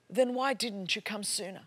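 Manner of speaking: normal talking voice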